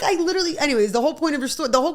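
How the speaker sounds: ordinary delivery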